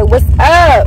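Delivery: loud, punchy "What's up?". Shown as a demonstration. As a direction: shout "What's up?" to the camera. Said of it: "say louder".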